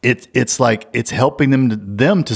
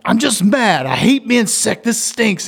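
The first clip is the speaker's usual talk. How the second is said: put-on higher, frustrated sick teenager venting; frustrated teen; 12 semitones higher than usual. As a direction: vent like a fed-up sick teen. Teen son quoted when sick.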